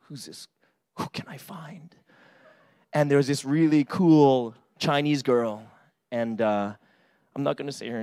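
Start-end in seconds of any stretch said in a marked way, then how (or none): 0.1-1.9 much softer, breathy whisper. Retold or imitated. Student scanning campus for girls in a whisper.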